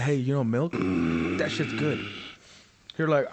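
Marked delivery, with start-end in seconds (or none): 0.0-2.0 smug teasing. Pretend. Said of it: "be like".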